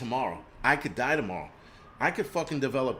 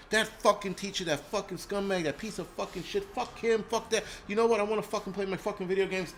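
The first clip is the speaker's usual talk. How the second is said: higher mock kid voice, ranting; imitating a cursing kid; 7 semitones higher than usual. Vlogger imitates kids cursing to their friends, higher mock voice. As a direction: play a foul-mouthed kid griping to his friends. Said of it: "when they're talking to their friends".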